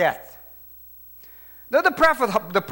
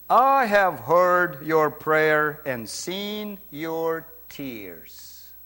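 solemn divine answer; much slower than usual. Acted. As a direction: draw it out gravely, let the end die away. Voicing God's answer to prayer.

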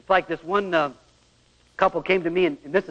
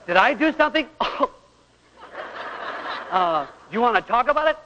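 higher, laughing questions; laughing husband; 5 semitones higher than usual. Retold or imitated. Husband nervously checking on wife, laughing. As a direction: ask through chuckles.

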